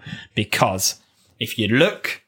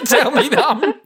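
laughing; 14 semitones higher than usual. Real, not acted. Helpless laughter.

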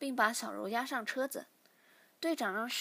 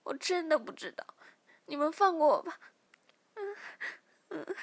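higher, louder crying plea; weeping captive begging; tearful plea; 7 semitones higher, 6 dB louder than usual. Acted. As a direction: beg through tears. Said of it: "小柔流着眼泪".

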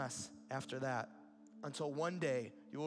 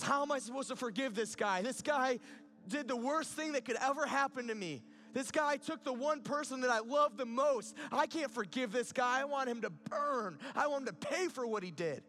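high, loud, pained outburst as a wronged man; anguished anger; 9 semitones higher, 6 dB louder than usual. Acted. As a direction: protest in hurt and fury, pitched well up. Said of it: "just filled with grief and anger and hurt".

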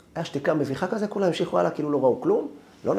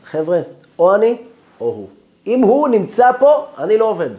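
loud, high ultimatum; announcing to everyone; 10 semitones higher, 11 dB louder than usual. Retold or imitated. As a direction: declare it to the whole room. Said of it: "והודיע לכולם". (הכרזה רמה ונחרצת לכולם)

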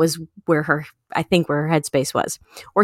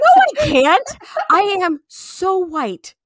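laughing protest; 7 semitones higher than usual. Retold or imitated. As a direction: squeal it out, laughing in disbelief. Retells own horrified refusal.

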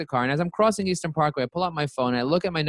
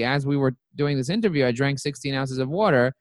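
usual speaking voice

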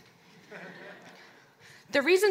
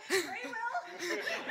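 breaking up in giggles; losing it mid-sentence; helpless high laugh; 11 semitones higher, 10 dB quieter than usual. Real, not acted.

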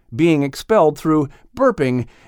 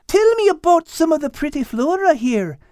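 put-on high, eager girl voice; curious and keen to learn; eager young Kate. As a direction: Kate eagerly asking Liz about flowers. Kate asks Liz.

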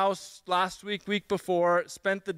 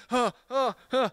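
short breathless huffs, a little higher; mock panting; 4 semitones higher than usual. Nervous guest meeting the dogs, mock panting. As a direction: act out being flustered and out of breath. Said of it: "I was like this".

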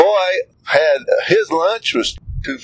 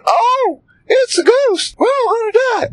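much higher, hammed-up shriek of fright; mock panic. Disciples seeing Jesus on the water, hammed-up high panic. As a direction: squeal it like a scared cartoon. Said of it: "I don't think he talked like that".

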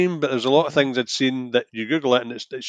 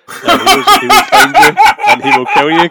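much higher, laughing mock threat; Liam Neeson impression; 13 semitones higher, 9 dB louder than usual. Mimicking the film line for a co-host, laughing mock menace. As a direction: ham up the film's famous threat through laughter. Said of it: "Liam Neeson played him".

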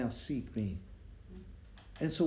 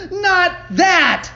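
very high, very loud outcry; horrified protest; 15 dB louder than usual. A narrator's reading. Companion rejecting John's prayer, horrified. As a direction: shout the refusal in dismay.

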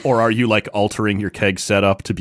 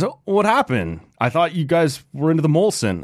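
surprised, high; 8 semitones higher than usual. Retold or imitated. Bartender surprised by switch.

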